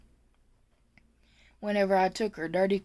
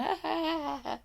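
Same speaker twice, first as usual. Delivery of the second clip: high, soft mock laugh; imitated laughing; 8 semitones higher, 6 dB quieter than usual. Storyteller imitating the other aides amused at her, mock laughing. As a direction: imitate coworkers' laughter, falling in pitch. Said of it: "they're just laughing".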